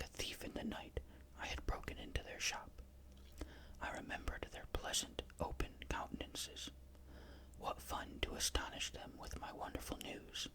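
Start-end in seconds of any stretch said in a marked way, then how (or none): none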